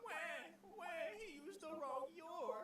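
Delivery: high, drawn-out mock wail; mock crybaby. Streamer mocking a grammar complaint, high crybaby whine.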